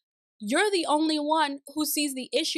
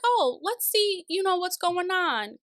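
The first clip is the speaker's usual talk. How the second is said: eager curiosity; 7 semitones higher than usual. Retold or imitated. Acting out her earlier eager curiosity.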